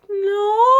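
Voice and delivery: high, loud mock wail; mock crying. Girl asked if she remembers adoption, mock crying. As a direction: wail it like a distraught kid. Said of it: "I was, like, crying".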